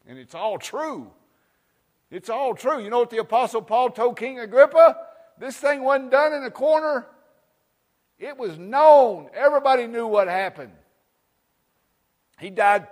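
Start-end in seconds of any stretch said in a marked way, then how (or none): 2.1-10.7 loud, high preaching at full force. Acted. Preacher proclaiming to his congregation, loud and high. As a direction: proclaim it to the whole church.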